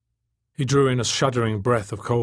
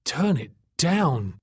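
slow, high, strained croak; weak croak; 5 semitones higher, much slower than usual. A narrator's reading. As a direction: croak it out weakly, word by word. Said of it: "Tak croaked weakly".

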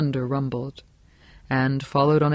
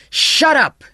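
loud, high-pitched furious shout; roared; 12 semitones higher, 8 dB louder than usual. A narrator's reading. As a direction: roar to silence the hysterical girl. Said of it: "he roared".

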